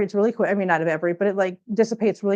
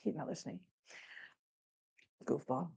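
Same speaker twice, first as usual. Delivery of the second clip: much softer, under the breath; muttered aside; 6 semitones lower, 20 dB quieter than usual. Real, not acted. Owner muttering at the cat who won't listen. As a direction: grumble it to yourself.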